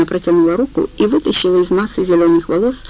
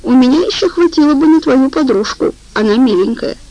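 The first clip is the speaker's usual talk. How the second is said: higher, louder and self-satisfied; smug; 6 semitones higher, 3 dB louder than usual. A narrator's reading. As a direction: brag about it with a smug grin. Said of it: "очень самодовольно сказал".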